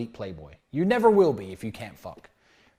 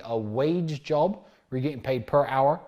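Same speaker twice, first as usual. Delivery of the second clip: ordinary delivery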